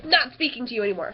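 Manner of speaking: loud, curt dismissal; hammed-up final refusal; mock-angry hang-up. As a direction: cut the caller off in pretend exasperation. Retold hanging up on creepy child.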